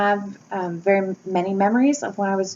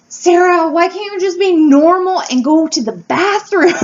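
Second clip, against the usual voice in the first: mom scolding louder and higher through a laugh; imitating an exasperated mother, laughing; 7 semitones higher, 7 dB louder than usual. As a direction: play the fed-up mother, half laughing. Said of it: "she'd be like".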